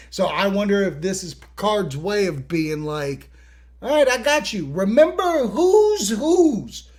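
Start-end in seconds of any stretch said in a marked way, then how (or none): 3.8-6.9 high-pitched mock authority, slowing down; Picard. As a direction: stretch out a put-on warning. Imagined captain asserting rank over Riker.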